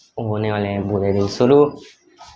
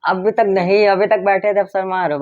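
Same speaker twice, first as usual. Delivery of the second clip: teasing; 8 semitones higher than usual. Real, not acted. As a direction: tease him for getting shy, sing-song. Teasing shy younger brother. (चिढ़ाते हुए ऊँची आवाज़ में)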